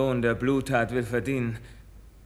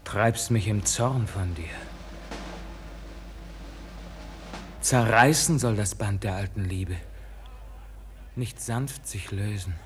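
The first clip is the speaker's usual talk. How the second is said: hurt pleading; 7 semitones lower, 5 dB quieter than usual. Acted. Pleading farewell to beloved father.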